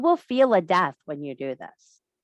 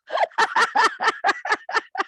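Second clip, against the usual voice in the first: real laugh, much higher; delighted high laugh; high cackling laugh; 4 dB louder than usual. Real, not acted. Laughing at own joke.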